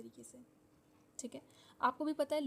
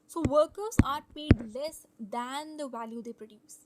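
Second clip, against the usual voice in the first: slow dictation; 9 dB louder, much slower than usual. Shown as a demonstration. Teacher slowly dictating a definition to her students. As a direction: spell it out slowly, word by word.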